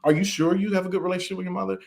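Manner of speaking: high, rapid imitation of a doubting question; mock prying questioner. Interviewer prying about his mother, mock doubtful questioning. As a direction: mimic someone nosily pressing for doubt.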